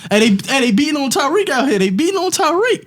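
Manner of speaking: high-pitched imitation of a frantic call for help; high, urgent mock alarm; Jonnu Smith. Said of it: "Jonnu Smith was like".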